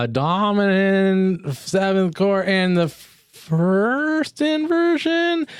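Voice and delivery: stretched words climbing very high; slow, singing rise in pitch; hammed-up musical jargon. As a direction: draw it out, climbing up the scale. Hamming up chord explanation.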